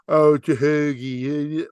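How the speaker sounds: slow, drawn-out Philadelphia "Hoagie" parody; slow Philadelphia accent imitation; mock Philly accent. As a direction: drag out the Philly vowels to mock the Delco moms. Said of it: "they're doing the Hoagie accent again".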